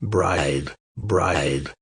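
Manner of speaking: low, soft, hollow-sounding voice of a dead horse's head; quiet, deep talking horse head; horse head's voice. Acted. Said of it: "And the head answered".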